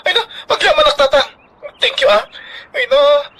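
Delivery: high, choked gratitude; tearful thanks. Acted. Grateful child thanking father, tearful and high.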